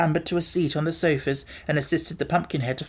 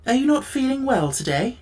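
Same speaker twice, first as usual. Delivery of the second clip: higher, caring question; a touch of worry; concerned Tin Woodman; 9 semitones higher than usual. A narrator's reading. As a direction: ask gently with concern. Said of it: "excellent heart was strongly affected".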